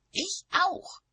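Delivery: high, loud comeback; loud high-pitched witch retort; indignant retort. A narrator's reading. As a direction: fire it back loudly at high pitch.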